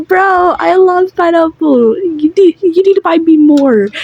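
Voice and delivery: high, whiny fake crying; pleading in a put-on tearful voice; mock crying. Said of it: "he starts, like, crying".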